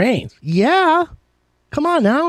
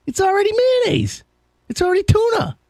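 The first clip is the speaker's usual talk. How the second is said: excited pitch. Real, not acted. Excited pitch of his invention.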